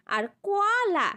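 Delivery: very slow কলা, each syllable held; drawn-out demo. Shown as a demonstration. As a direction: stretch কলা out so pupils hear the open syllables. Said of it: "দুটো অক্ষরকে একসঙ্গে উচ্চারণ করেছি আম".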